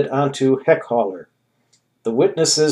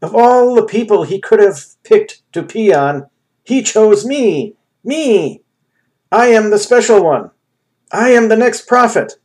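ecstatic raving; 9 semitones higher, 6 dB louder than usual. A narrator's reading. Ham raving after being chosen.

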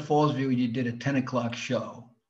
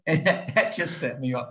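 normal talking voice

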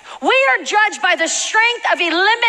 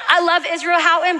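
usual speaking voice

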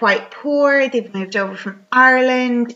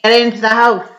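louder, retold command; mock stern order; 6 dB louder than usual. Retelling Dan at the farmhouse, mock stern order. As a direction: order them indoors, lightly acted.

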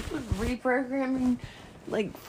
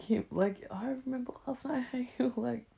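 usual speaking voice

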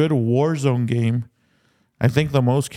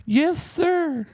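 put-on little kid voice. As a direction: answer like an obedient little boy. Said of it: "the one that's playing the little kid".

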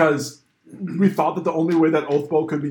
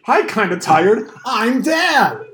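booming "I'm Dad" punchline, rising higher; hammy dad joke; 5 semitones higher, much slower than usual. Pretend. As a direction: greet with mock earnestness, then land the punchline high.

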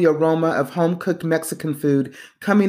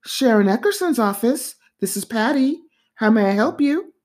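higher, chirpy office receptionist; high, polite phone receptionist greeting a caller; 7 semitones higher than usual. A narrator's reading. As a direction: pitch it up like a polite secretary answering. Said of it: "The voice on the other end of the phone said".

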